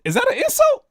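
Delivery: very high, incredulous squeak; high, stunned question; baffled. Real, not acted. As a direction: ask in total disbelief. Baffled by the Harvard jab.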